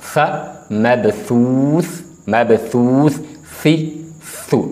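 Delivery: सा मबसूस drawn out slowly, tongue at the teeth; letter drill. Shown as a demonstration. Teacher drilling the letter सा for students. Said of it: "तो इसको पढ़ते हैं हम सा".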